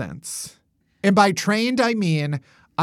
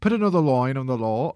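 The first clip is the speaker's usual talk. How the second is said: put-on posh British accent; 5 semitones lower than usual. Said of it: "That's how the Queen talks".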